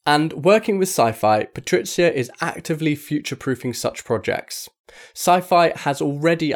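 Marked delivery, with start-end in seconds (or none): none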